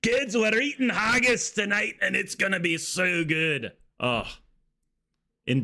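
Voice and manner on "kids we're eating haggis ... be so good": excited higher-pitched Scot; Scottish accent. Acted. Scottish parent telling kids about dinner, excited Scottish accent. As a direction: rejoice over haggis in broad Scottish.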